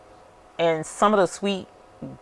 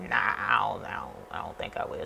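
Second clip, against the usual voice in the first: reluctant refusal; 8 semitones lower, 11 dB quieter than usual. Retold or imitated. Retold refusal of pickled okra.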